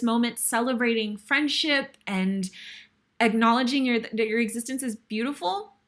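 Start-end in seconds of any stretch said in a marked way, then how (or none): none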